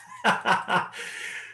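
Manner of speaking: short unvoiced chuckle; real amused huff; breathy laugh. Real, not acted. Missed trade on chart.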